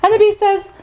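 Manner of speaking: ordinary delivery, nothing marked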